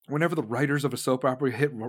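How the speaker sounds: normal talking voice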